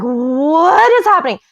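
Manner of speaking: high, loud, incredulous cry; retold disbelief. Woman retelling her shock at girlfriend's act, incredulous. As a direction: act out being utterly baffled.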